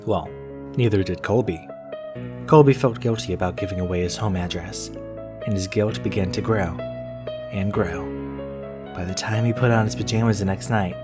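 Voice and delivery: usual speaking voice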